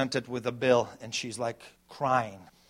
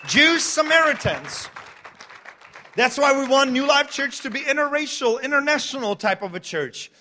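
preaching at full force; 10 semitones higher, 7 dB louder than usual. Acted. Pastor to congregation, full-force preaching. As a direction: preach it out to the whole church.

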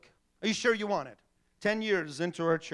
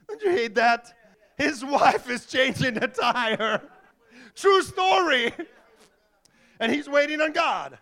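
laughing loud; 7 semitones higher, 7 dB louder than usual. Real, not acted. Preacher laughs, exclaims to congregation.

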